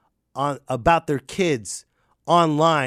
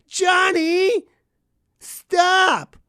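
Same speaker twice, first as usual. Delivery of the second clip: much higher, louder nagging parent calling Johnny; whiny parent call; 4 dB louder, much slower than usual. Retold or imitated. Mocking a useless parent calling out to Johnny. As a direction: call the kid's name in a helpless, high whine. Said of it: "I've seen parents".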